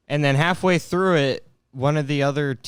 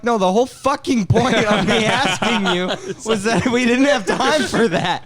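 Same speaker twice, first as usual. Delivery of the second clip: higher, louder exasperated retort; retold exasperation; 7 semitones higher, 4 dB louder than usual. Retelling the reply to a forgetful brother, loud exasperation. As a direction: vent the frustration as you retell it.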